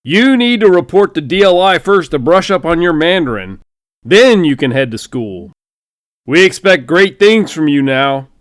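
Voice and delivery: loud, high hearty bellow ending in a shout; a blustering general barking orders like Foghorn Leghorn; booming general. Acted. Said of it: "Foghorn Leghorn".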